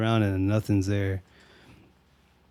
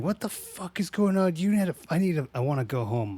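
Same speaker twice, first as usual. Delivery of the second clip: put-on high, frantic girl begging to leave; mock panic; 5 semitones higher, faster than usual. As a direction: play her freaking out, a bit hammed. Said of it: "she's like".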